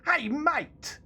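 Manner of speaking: loud, high hammed-up British "Hey, mate!" with a big "hey"; mock British accent. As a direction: shout "Hey, mate!" as a cartoon Brit, mocking the game voice. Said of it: "it's so bad".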